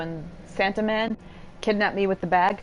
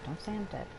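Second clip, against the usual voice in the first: much softer, almost under breath; quiet murmur; 11 dB quieter than usual. Acted. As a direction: murmur it to yourself. Repeats the line, second softer.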